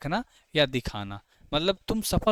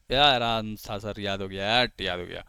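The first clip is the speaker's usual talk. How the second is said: voiced student reply; 7 semitones lower than usual. Teacher speaking as a student who has memorised it, low voice. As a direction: play a student answering, pitched well down.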